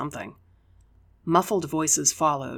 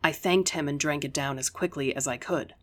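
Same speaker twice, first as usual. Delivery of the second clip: ordinary delivery, nothing marked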